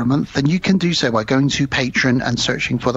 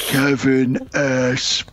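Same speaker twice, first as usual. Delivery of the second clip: slow drawn-out zombie groan; much slower than usual. Pretend. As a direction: moan the names like the undead. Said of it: "in my best zombie voice".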